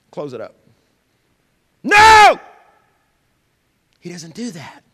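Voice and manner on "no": sudden huge shout, high and loud. Real, not acted. Preacher to congregation, a sudden shouted no. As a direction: bellow it out to the whole church.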